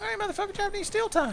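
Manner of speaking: very high, hammy battle taunt; hammed-up battle cry. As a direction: squeal it out like a hero. Hyping final attack.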